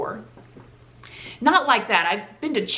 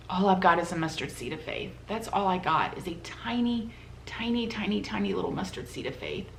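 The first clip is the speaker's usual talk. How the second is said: much softer, fading to a near whisper; hushed to herself; 2 semitones lower, 9 dB quieter than usual. Retold or imitated. Girl alone in the dorm bed, hushed inner thought. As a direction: murmur it like a secret prayer.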